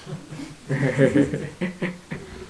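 long soft chuckle; amused laugh. Real, not acted. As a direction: let a chuckle run on. Amused at the clock.

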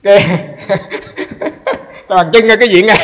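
laughing through the words. Real, not acted. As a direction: burst out laughing mid-story. Laughing at the story. (vừa nói vừa cười)

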